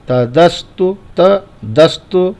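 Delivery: तदस्तु as त, a short break, then दस्तु; first syllable separated. Shown as a demonstration. Teacher shows ta coming separately, split delivery. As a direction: set त apart from दस्तु in तदस्तु. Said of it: "तो ता अलग हो जाएगा".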